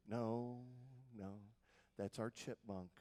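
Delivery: soft, low, unhurried dad; hushed, low voice of a father; dad's voice. Retold or imitated. Voicing dad in a story.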